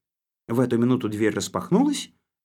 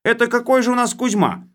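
high, loud, bossy bureaucrat voice; blustering official; 12 semitones higher, 7 dB louder than usual. Acted. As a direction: lay down the law like a boorish boss. Khlebovodov interrogates.

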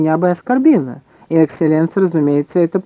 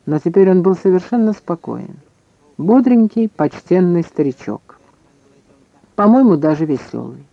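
ordinary delivery, nothing marked

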